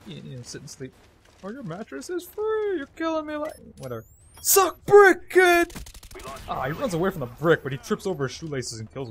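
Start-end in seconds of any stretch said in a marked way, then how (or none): 1.4-3.7 put-on catchphrase voice. Player parodying a mattress catchphrase, high silly voice. 4.4-5.7 high, loud mock battle cry; taunting shout. Player taunting the enemy he hit, loud high shout.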